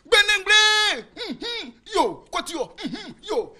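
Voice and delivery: high, drawn-out trance chanting with grunts. Acted. Adult pupil falling into a trance, high and chanting. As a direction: channel a spirit in a trance. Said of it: "il tombe en transe".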